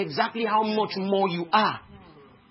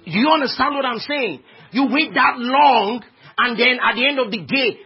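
high, loud sermon climax; full-force preaching; 6 semitones higher, 8 dB louder than usual. Real, not acted. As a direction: shout it out to the congregation.

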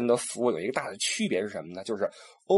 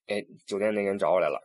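ordinary talk

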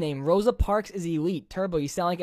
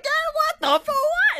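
much higher, squeaky, far from his own voice; high squeaky outburst; 4 dB louder, slower than usual. Shown as a demonstration. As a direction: show how a high squeal makes a joke land. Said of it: "the way your tone of your voice affects".

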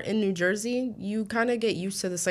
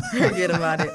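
laughing; 5 dB louder than usual. Real, not acted. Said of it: "like, forget about it".

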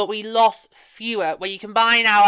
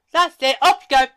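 higher, brisk send-off in mimicry; mock dismissal; 7 semitones higher, 4 dB louder than usual. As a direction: play an official cheerfully waving someone off. Voices bosses sending team away.

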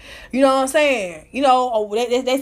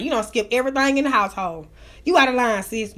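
ordinary delivery

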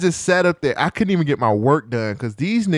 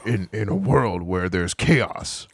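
movie trailer voice; 8 semitones lower, 4 dB quieter, slower than usual. Pretend. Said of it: "the trailer voice man to come up and be like".

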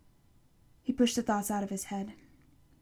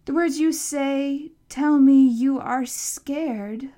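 slow, loud, raised voice; deliberate monster; 4 semitones higher, 8 dB louder, slower than usual. A narrator's reading. Monster puzzling over the boy's words, slow and deliberate. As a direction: spell it out slowly and loudly. Monster slowly reasons with boy.

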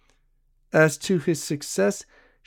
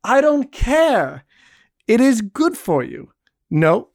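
high, slow, louder brush-off; playful scolding; 8 semitones higher, 5 dB louder than usual. A narrator's reading. Barbe brushes off Jean's plea, high and loud. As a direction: tease him with a firm refusal. Barbe teasingly refusing Jean.

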